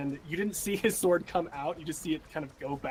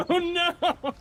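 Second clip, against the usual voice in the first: high, squealing mock dismay; giggling oh no; laughing mock panic; 8 dB louder than usual. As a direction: yelp it out between laughs. Mock panic at plot twist.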